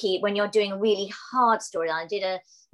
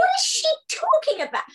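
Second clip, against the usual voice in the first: high, loud squeal of bafflement; baffled squeal; 5 dB louder than usual. Retold or imitated. Actress baffled by a stranger's advice, squealing. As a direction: squeak out her confusion like a cry. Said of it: "And I'm thinking".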